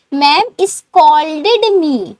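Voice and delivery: very slow and drawn out; slow model sentence. Shown as a demonstration. Teacher slowly models the English answer for students. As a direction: lay out each word for learners.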